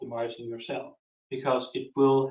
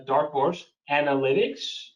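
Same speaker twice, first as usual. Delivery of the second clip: repeating the name. Real, not acted. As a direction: spell out "Dark Horse Analytics" word by word for note-takers. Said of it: "if you could repeat the name".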